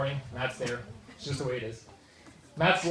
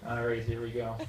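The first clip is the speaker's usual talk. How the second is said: ordinary talk, nothing marked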